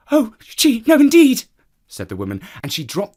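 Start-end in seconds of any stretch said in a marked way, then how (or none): 0.1-1.4 high, loud panicked squeal; frightened cry. Acted. Woman jumping from a mouse, high panicked cry. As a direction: shriek at the mouse.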